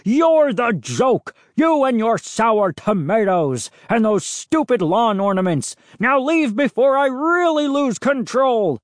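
high, heated angry outburst; growled anger. A narrator's reading. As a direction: rage at him, close to losing control. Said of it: "Mister McCall growled".

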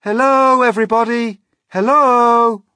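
high, loud, long hello; calling out. A narrator's reading. Small boy greeting the crowd from the peach, calling out. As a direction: hail a crowd far below. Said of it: "laughing and waving and calling out".

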